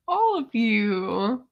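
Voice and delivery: tearful laugh through the words; choked up, laughing while crying; real crying laugh. Real, not acted. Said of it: "You're literally making me cry".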